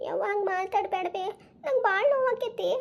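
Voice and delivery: high, drawn-out put-on wail; tearful pleading. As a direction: plead as a wounded daughter, faking tears. Pretend-crying daughter pleads with mother. (ಅಳುತ್ತಾ ಬೇಡಿಕೊಳ್ಳುವ ನಾಟಕದ ದನಿ)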